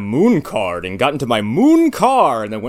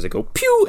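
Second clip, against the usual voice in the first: mock laser pew. Imitating a laser sound.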